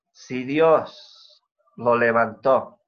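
"Si Dios lo levantó" stretched out, every word weighed; slow savoring emphasis. Acted. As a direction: linger over "Si Dios lo levantó". Preacher savours the verse.

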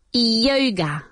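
ordinary talk, nothing marked

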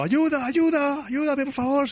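high, slow, pleading falsetto; imitating an old man calling for help; mock cry for help. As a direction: wail it like a hammed-up victim. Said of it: "se oye un hombre gritando".

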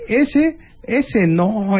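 high, scoffing dismissal. Retold or imitated. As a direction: scoff like a doubtful crowd. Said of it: "todo el mundo dice".

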